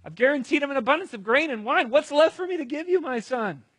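high, loud, acted dismay; distraught father, voice way up; helpless exasperation. Isaac helpless in acted quarrel.

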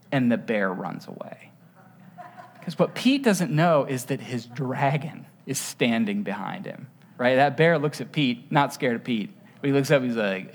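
normal talking voice

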